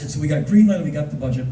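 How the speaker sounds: normal talking voice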